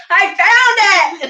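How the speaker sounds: high, slow insistent shout; retold thrilled repeat; excited shout. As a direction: stretch it out, thrilled. Repeating to David.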